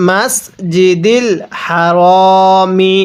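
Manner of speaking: slow, drawn-out Quranic chant; measured tajweed recitation. Shown as a demonstration. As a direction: recite each word slowly and melodically.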